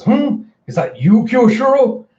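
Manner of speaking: high, loud mock surprise; retold startled recognition; startled questioning. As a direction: question it in exaggerated disbelief. Kaido acted in surprise.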